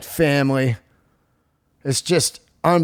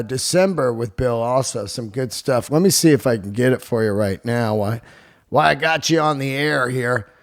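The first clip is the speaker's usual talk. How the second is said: ordinary delivery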